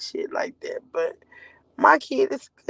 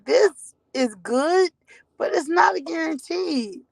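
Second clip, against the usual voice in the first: tearing up; 7 semitones higher than usual. Real, not acted. Tearful about her son's future.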